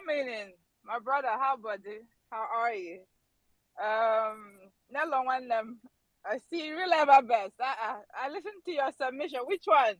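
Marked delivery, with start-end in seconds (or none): none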